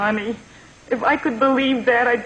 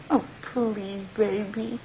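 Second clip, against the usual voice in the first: soft pleading; 8 dB quieter, slower than usual. Acted. Mother begs son softly.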